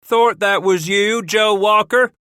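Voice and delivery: high, loud calling; cried out. Acted. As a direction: cry out across the gate. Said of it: "a new voice cried".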